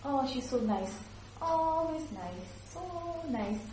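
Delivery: high, slow sugary gushing; mock nice lady. Shown as a demonstration. Teacher mimicking praise of a nice lady, high and drawn out. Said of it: "A nice lady".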